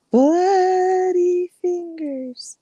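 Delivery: very slow, high, drawn-out ghostly moan; eerie ghost voice. Acted. Storyteller at a campfire doing the ghost's rising moan. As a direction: stretch every syllable like a haunting. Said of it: "he hears it again".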